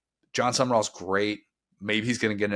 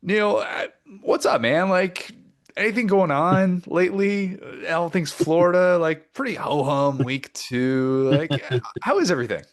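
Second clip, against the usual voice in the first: upbeat host greeting; 9 semitones higher, 5 dB louder than usual. Acted. Host greets podcast guest.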